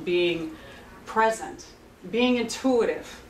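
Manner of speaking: normal talking voice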